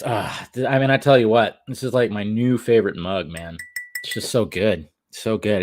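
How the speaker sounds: normal talking voice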